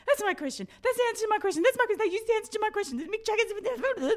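high, loud, rapid excited babble; excited insisting. Retold or imitated. Daughter insisting to doubtful parents, excited and high. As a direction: insist breathlessly it really is yours.

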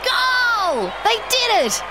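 high, joyful yell of victory; triumphant shout. A narrator's reading. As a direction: scream it like your team just scored. Team scores, fan celebrates.

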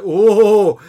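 lightly hammed disbelief; mock exasperation. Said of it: "It's like".

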